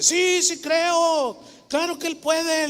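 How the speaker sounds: put-on high, slow, earnest old man's yes; lightly voiced old man, eager; grandfather answering. Grandfather answering the woman praying, lightly voiced old man.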